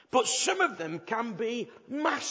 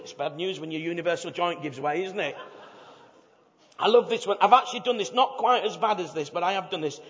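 ordinary talk, nothing marked

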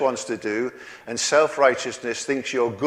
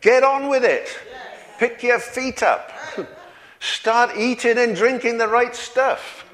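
cheering cloud of witnesses; 10 semitones higher than usual. Retold or imitated. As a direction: call it out high like the saints cheering him on. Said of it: "they're saying to you and me today".

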